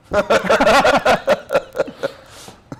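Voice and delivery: a long loud laugh rolling out; long hearty laugh; long high laugh. Real, not acted. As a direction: laugh hard at the idea. Laughs at good guy Russian.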